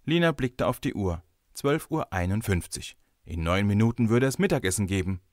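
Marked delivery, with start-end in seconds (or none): none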